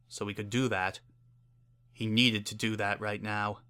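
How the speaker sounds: ordinary delivery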